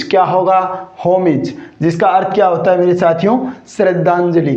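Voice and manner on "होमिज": "होमिज" with short i ending; correct pronunciation. Shown as a demonstration. Teacher gives students the correct "होमिज" with short i. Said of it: "इसका भी correct pronunciation क्या होगा?".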